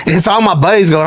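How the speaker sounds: ordinary talk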